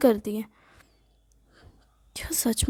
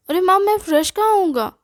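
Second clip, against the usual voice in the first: whiny protest; 6 semitones higher, 4 dB louder than usual. Acted. Son whining excuses to mother.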